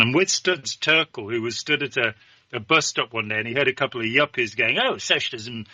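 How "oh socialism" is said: mock-posh English royal accent, leaping high in pitch; Queen of England's accent. Yuppies at a bus stop complaining about socialism, in the Queen's accent. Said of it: "wouldn't have said it in the Queen of England's accent".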